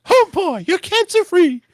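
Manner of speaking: very high, loud cartoon squeak; Mickey Mouse. Host as cartoon mouse telling a child the good news, squeaky falsetto. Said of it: "having Mickey say it".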